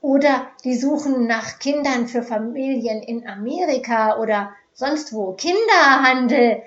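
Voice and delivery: keifing woman from the back rows; shrill scolding. A narrator's reading. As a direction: screech the accusation at the crowd. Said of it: "keifte eine Frau".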